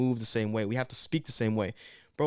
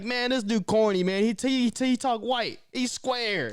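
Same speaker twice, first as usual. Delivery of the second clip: higher, sneering peer voice; mocking peers; 9 semitones higher, slower than usual. Imitating peers mocking how he talks, higher. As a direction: mimic kids calling him corny. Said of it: "they're like".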